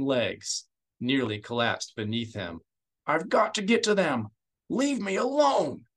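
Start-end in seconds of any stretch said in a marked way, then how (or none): 3.1-4.3 high, loud, frantic plea; straining to reach his family; anguished desperation; desperate. A narrator's reading. Senator fights to reach family. 4.7-5.9 very high, slow, breaking cry; begging through grief; broken, pleading cry; anguished plea. A narrator's reading. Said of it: "Bel Iblis snarled".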